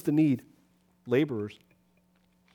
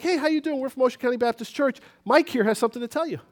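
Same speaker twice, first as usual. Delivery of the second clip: higher, bright door-to-door greeting; cheerful greeting at a door; 6 semitones higher than usual. Retold or imitated. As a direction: greet a stranger at the door, upbeat. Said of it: "we're like".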